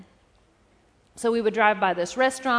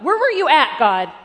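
louder, higher plea; crying out to God; 3 semitones higher, 10 dB louder than usual. Retold or imitated. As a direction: demand an answer from God. Asking God, raised voice.

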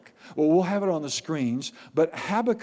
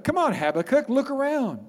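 coaxing; 9 semitones higher than usual. Acted. God urging Habakkuk in retelling.

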